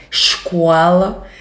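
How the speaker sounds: Escola as iscola, Carioca hissing S, slow; Carioca accent. Shown as a demonstration. Teacher imitates the Rio de Janeiro way of saying Escola.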